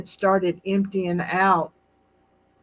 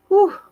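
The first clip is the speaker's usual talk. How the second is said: high loud whew of amazement; big whew; 12 semitones higher, 6 dB louder than usual. Real, not acted. Host marvelling at her mother's long life, loud high whew. Exhaling about mother's age.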